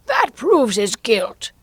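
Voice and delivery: high, loud, slow verdict; imperious queen. A narrator's reading. Queen condemns the knave, high drawn-out royal voice. The Queen declares guilt in court.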